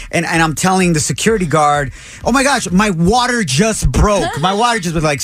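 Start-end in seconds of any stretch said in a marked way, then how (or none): 2.2-5.0 acted-out panicky new dad; imitated freaking out. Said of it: "I was freaking out so much".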